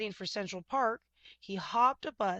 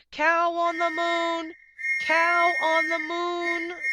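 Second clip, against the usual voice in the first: slow, very loud, high alarm shout; guard shouting; 10 semitones higher, 12 dB louder, slower than usual. A narrator's reading. Museum guard finding the cow, shouting in alarm. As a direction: yell the alarm at the top of your lungs. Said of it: "the guard shouted".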